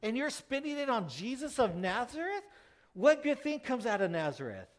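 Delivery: high, loud, outraged protest; indignant disciples. Retold or imitated. Disciples scold Mary over the costly oil, high-pitched indignant outburst. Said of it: "They're going".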